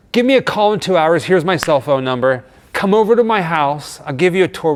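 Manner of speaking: lightly voiced friendly host; imitating Tom's invite. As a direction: play Tom as an eager, generous host. Retold Tom inviting them over.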